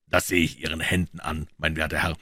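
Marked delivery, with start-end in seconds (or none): none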